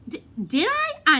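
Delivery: cornered and stalling; nervous stammer. Acted.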